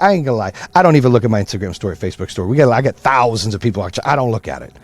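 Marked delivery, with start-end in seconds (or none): none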